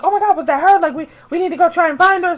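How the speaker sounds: flustered fan in a hurry; retold panic. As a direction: blurt it out in a fluster. Retold panic at missing the star.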